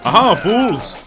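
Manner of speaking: high, loud villainous taunt; stagey fake archaic declaiming. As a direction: gloat like a hammy knight. Taunting enemies again.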